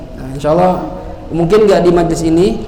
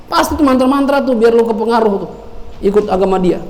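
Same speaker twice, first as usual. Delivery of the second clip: sneering suspicion; 5 semitones higher than usual. Acted. Umar sneers about spells.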